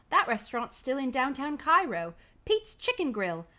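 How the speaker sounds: slow, badly faked Manchester accent; wobbly mock Manchester English. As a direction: attempt a Manchester accent, stretching the words. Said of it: "Guy's from Manchester for some reason".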